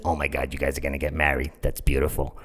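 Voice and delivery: very low, languid voice; imitating the forward stranger, low and softened; seductive woman. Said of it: "just that kind of voice".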